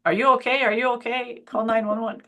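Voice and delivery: playful CPR drill call-out; mock emergency check. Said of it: "I'm well versed on".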